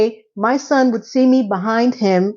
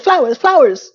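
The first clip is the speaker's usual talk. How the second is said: higher, louder incredulous repeat; mock the excess, flowers, flowers, pitched up; scoffing at the bride's flowers, flowers, raised and louder; 6 semitones higher, 4 dB louder than usual. Mocking the bride's demand.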